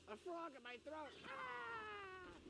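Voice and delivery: high, slow puppet cry; high squeaky dummy yelp. Retold or imitated. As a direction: shriek like a cartoon puppet. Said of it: "he goes".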